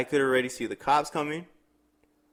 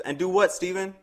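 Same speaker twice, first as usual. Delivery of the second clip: higher, pushy demand; mock demanding challenge; 8 semitones higher than usual. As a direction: throw the question back like a dare. Girlfriend demanding, retold quote.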